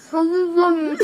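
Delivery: laughing mouth full. Real, not acted. Marshmallow number three in mouth.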